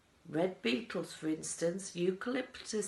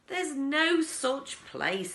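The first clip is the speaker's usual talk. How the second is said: indignant; 8 semitones higher, 6 dB louder, slower than usual. A narrator's reading. Miss Salt objects.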